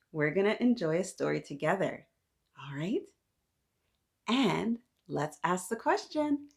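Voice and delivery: plain reading voice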